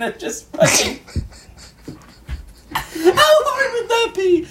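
high squeaky laughing question. Real, not acted. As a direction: crack up while asking it. Laughing hard mid-sentence.

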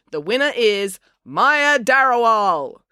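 high, loud and drawn out; winner announcement. A narrator's reading. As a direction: proclaim the champion slowly to the crowd. Finn announces the race winner.

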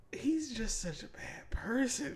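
hushed, slow whisper. Real, not acted. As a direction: drop to a whisper. Disgust about him, hushed.

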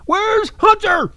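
high, slow teasing call; mocking sing-song.

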